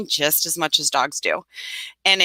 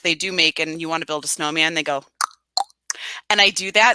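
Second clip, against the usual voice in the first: ordinary talk, nothing marked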